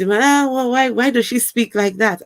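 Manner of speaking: high, incredulous mock quote; lightly voiced scoffing critic. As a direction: imitate a puzzled critic mockingly. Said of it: "I heard someone saying".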